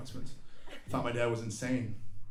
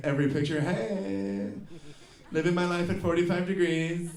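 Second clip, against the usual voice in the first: lightly voiced selfie girl, slightly higher; hint of put-on influencer voice; mock selfie girl; 4 semitones higher than usual. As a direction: imitate a posing influencer. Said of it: "she's like".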